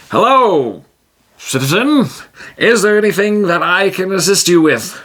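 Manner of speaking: a pompous helper at the door; formal hero voice. Acted. Tijim offering help to the sick orc, pompously polite.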